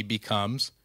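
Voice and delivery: ordinary delivery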